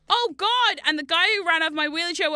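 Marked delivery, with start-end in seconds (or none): 0.1-0.8 re-enacted cry of alarm, high and loud; alarmed outburst. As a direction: blurt a loud, high "oh God" in mock panic. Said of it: "and I went".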